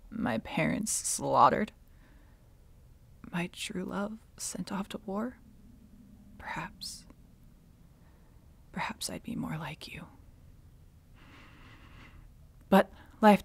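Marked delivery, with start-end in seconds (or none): 3.3-10.1 hushed, halting recollection; quiet, pained musing; wistful regret. Acted. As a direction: let it sink softly into regret. Villainess recalls her losses wistfully.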